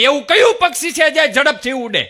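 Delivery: loud, high schoolboy question; mock loud student question; quiz question, loud. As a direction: blurt the riddle out loud and high. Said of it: "ફરીથી બોલો".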